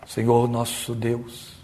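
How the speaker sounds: low, hushed, very slow invocation; solemn liturgical prayer. Retold or imitated. Church brother opening the service in prayer, solemn liturgical drone. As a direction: intone it like a formal opening prayer. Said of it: "ele ora assim".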